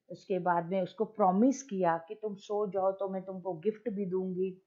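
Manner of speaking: normal talking voice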